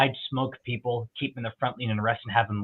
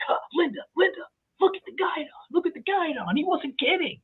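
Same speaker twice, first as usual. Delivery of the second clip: much higher, amazed father nudging his wife; excited dad. Retold or imitated. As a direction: squeak it like a dad who can't believe it. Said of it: "he's like".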